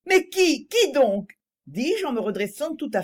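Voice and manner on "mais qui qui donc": high, louder startled questions; urgent surprise. A narrator's reading. Young woman waking from a faint, asking who died. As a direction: demand to know who, alarmed.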